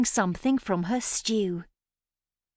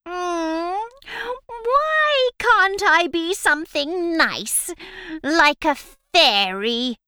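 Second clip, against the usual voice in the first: witch voice; 7 semitones higher, 5 dB louder than usual. Acted. Witch wishing to be fairy.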